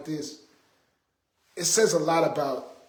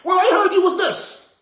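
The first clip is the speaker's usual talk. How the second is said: very high, loud, pestering gossip voice; mock gossiping people; 13 semitones higher, 6 dB louder than usual. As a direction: mimic nagging rumor-mongers, piling up high and loud. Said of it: "you can hear all the opinions of people".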